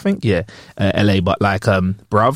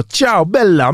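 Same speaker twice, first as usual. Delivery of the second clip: playful put-on Italian accent, pitched up; 5 semitones higher, much slower than usual. Tourist in Italy trying the accent, playful Italian accent. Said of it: "I try to use accent".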